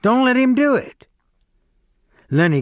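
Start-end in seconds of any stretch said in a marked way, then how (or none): 0.0-1.1 much higher, louder yell. Acted. George yelling at Lennie to fight back, high loud yell. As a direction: shout him on across the room.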